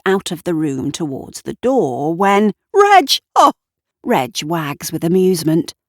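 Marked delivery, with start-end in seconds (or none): 2.7-3.5 high loud cry of surprise; jumping at the dog; high startled yelp; startled shout. A narrator's reading.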